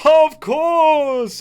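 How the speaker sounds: high, loud ghostly reply; unearthly voice. Acted. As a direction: grant it like a ghost. Ghost answers handshake request.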